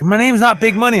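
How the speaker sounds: lightly hammed-up indignant kid; child's protest. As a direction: protest a teasing nickname like an offended kid. Dad voices his daughter's protest.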